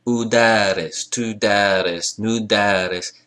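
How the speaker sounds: udaris, tudaris, nudaris slowly, oo clear at the front; clear opening oo. Shown as a demonstration. Teacher showing the oo, udaris, tudaris, nudaris said slowly. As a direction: lean on oo and middle beat of udaris, tudaris, nudaris. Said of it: "If you hear that oo".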